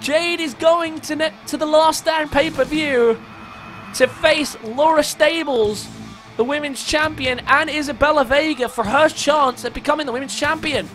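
high, ringing call of the win; excited commentary. Real, not acted. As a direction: sell the big finish to the crowd. Match-winning move and victory call.

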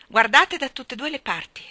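loud, high warning call. A narrator's reading. Mother to children crossing at the gate, calling out. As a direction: shout it after them. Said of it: "gridò loro".

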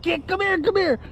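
very high, quick, softish dog-calling voice; squeaky-high playful coaxing; excited play voice. Retold or imitated. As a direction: call a puppy over in excited play. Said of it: "I get a little excited in my voice".

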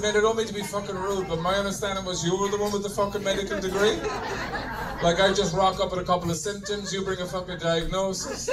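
normal talking voice

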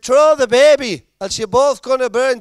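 very high, loud fireman's yell; urgent shout. Acted. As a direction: call up to someone on a high floor. Fireman calling up to trapped woman.

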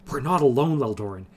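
a man choking back alarm; strangled voice. A narrator's reading. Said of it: "he said in a strangled voice".

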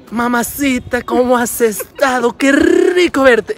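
high, slow, drawn-out Colombian "Qué rico verte"; Colombian greeting. Retold or imitated. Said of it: "aquí en Colombia es". (saludo colombiano lento y agudo, alargando "rico")

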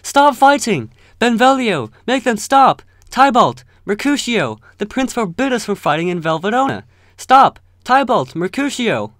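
high, loud frantic shouts; yelling to stop a fight. Acted. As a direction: scream at them to stop fighting. Benvolio yells to stop fight.

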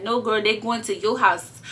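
usual speaking voice

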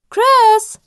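loud high call up the stairs; a mother calling her son to the phone, loud and high; shouting the name across the house. A narrator's reading. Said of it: "ruft sie".

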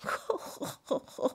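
breathy embarrassed snicker; a sheepish little laugh; soft snicker. A narrator's reading.

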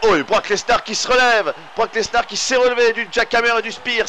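amazed he got up; surprised excitement. Real, not acted. Wrestler kicks out, surprise. (surprise excitée, plus aiguë)

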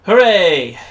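triumphant shouted hooray; loud cheer. Real, not acted. Escaping enemies, cheering.

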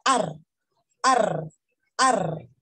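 "ar" with a trilled Spanish r; learner's rolled R. Retold or imitated. Teacher recalls learning English, mistaken trilled "ar". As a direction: imitate a beginner rolling the r in "ar". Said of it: "cuando yo estaba aprendiendo en inglés".

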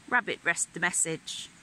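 ordinary delivery

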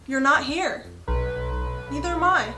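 ordinary delivery

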